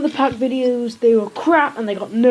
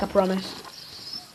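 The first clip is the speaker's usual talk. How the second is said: usual speaking voice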